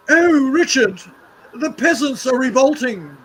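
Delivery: put-on high, loud posh English accent; a toff in upper class English fretting over peasants; hammed-up upper class English voice. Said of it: "my upper class fucking English voice".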